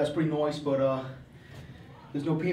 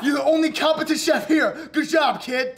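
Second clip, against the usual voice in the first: loud, high, booming praise; shouting chef; 10 semitones higher, 5 dB louder than usual. Acted. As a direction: yell the compliment across the kitchen.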